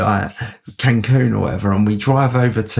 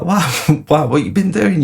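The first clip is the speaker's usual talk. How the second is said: higher, laughing wow; amused mock disbelief; laughing surprise; 7 semitones higher than usual. Amused reaction to mates' news.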